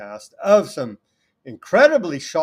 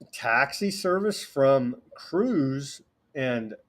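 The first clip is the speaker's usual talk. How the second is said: normal talking voice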